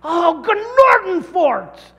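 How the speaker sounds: high, loud, slow hammed-up yell at the ref; mock angry outburst. Fouled in basketball, mock yelling at the referee. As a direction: blow up at a bad call. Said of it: "referee would call a foul on me".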